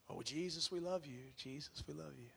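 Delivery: soft, tender prayer; soft worship. Shown as a demonstration. Preacher imitating worship songs to Jesus, soft. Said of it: "modeling typical worship".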